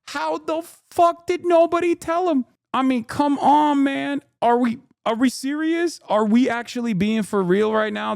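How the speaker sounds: high-pitched come-on-man; exasperated disbelief. Real, not acted. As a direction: voice climbs in outrage. Ranting nobody told him.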